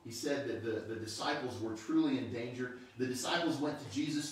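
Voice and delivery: normal talking voice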